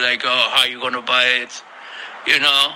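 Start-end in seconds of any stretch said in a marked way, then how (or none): 0.3-1.5 low, fast mocking scoff at a broke friend. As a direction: sneer it out, quick and low. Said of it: "they make fun of you".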